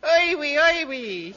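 loud mock French "oi wee"; hammy French lady voice; French accent. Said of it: "she said".

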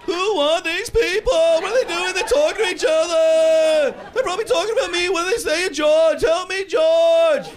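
high, drawn-out comic freak-out; panicked sitcom voice. Acted. Seeing little people on his fingers, high comic panic.

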